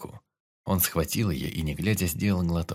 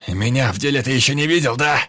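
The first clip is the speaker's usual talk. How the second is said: high, loud swaggering roar; bellowing; 6 semitones higher, 8 dB louder than usual. A narrator's reading. Pyotr yelling at the Indian, swaggering roar. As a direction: roar it with wild pride. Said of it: "орал Пётр".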